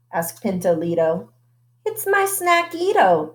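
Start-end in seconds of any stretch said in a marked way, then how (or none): 1.8-3.3 high, proud reply; high, cheerful kitty voice. A narrator's reading. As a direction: answer cheerfully like a kitten. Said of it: "replied the gatito".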